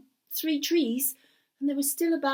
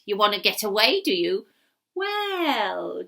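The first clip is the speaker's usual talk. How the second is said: the wizard toying with his captive; scheming wizard; 2 semitones lower, 6 dB louder than usual. Acted. Wizard taunting captive Gareth, sly and loud.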